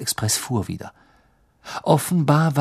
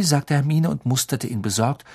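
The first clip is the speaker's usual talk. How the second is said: ordinary delivery, nothing marked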